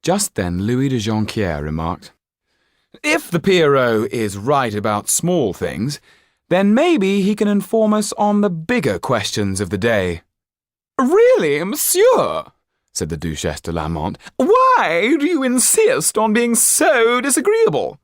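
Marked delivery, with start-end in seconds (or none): 3.0-10.2 higher, louder, sly provocation; Louis de Jonquière. Acted. Louis de Jonquière provoking the Pierrot, higher and louder. 11.0-12.5 much higher, louder reproach; an affronted duchess scolding; the Duchesse. Acted. The Duchesse scolding Louis, much higher and louder. 14.4-18.0 much higher, louder reproach; an affronted duchess scolding; the Duchesse. Acted. The Duchesse scolding Louis, much higher and louder.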